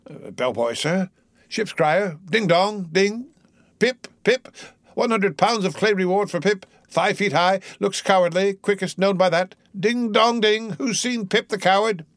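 high, sing-song town crier; crazed Pip crier. A narrator's reading. Mad Pip answers Ahab as ship's crier, crazed.

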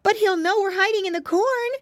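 put-on high piglet boy protesting; worried piglet. Flop doubts the hiding place, high worried voice.

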